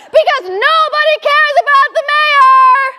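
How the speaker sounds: loud, high outburst; exasperated yell. Retold or imitated. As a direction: shout it in total exasperation. Exasperated climax of rant about the mayor.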